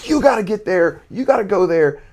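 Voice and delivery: high, urgent snap at a player; coach snapping. Retold or imitated. Coach to a kid when he gets right there, snapping. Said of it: "I'm snapping".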